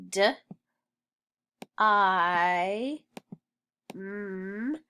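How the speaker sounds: "D, I, M" as separate sounds with long pauses; sounded out. Shown as a demonstration. Teacher sounding out dime as pupils write, sounded out. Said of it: "word we're gonna write is dime".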